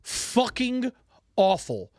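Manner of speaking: slow, heavy pauses between words; drawn-out disgust. Real, not acted. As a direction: let each word land separately in disgust. Ranting about terrible game.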